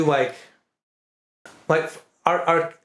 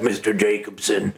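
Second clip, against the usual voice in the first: character voice; 6 semitones lower than usual. Retold or imitated. Describing a friend's voice work, a low mock name call. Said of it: "he does the voices of that".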